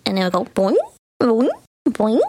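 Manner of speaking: springy boing, boing, boing; boingy sound effect. Retold or imitated. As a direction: bounce each boing like a spring. Imitating the camera sound effect.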